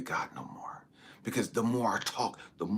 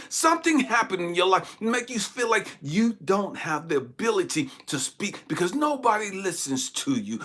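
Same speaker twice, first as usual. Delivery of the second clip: raised, insistent refrain; impassioned preaching; 10 semitones higher, 9 dB louder than usual. Real, not acted. Preacher telling listeners why they feel helpless, impassioned. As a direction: hammer each repeated phrase with rising force.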